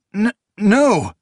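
frightened stammer. A narrator's reading. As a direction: trip over the first sound in fear. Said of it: "he stammered".

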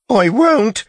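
very high, loud squeaked protest; near squeak. A narrator's reading. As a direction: squeak it out like a scared youth. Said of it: "voice nearly a squeak".

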